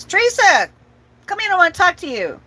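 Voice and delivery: high, loud call into the house. Retold or imitated. Dad in his recliner calling his daughter inside, loud. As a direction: call her name across the yard.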